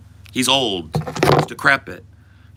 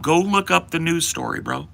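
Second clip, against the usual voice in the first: usual speaking voice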